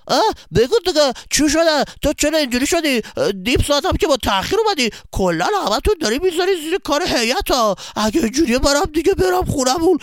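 fed-up friend venting; angry friend scolding the latecomer; loud complaining. Acted. Alireza complaining loudly about absent helpers.

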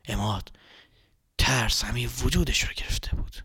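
soft spooky whisper of the name; hushed whisper. A narrator's reading. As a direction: breathe the name like a secret. Narrator hushes a scary moment.

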